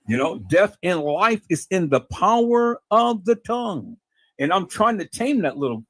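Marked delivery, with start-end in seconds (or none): 0.4-4.0 slow, drawn-out proverb with weight on each phrase; slow emphatic repeat. Real, not acted. Preacher repeats Proverbs to listeners, slowly and emphatically. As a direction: stretch it out for emphasis.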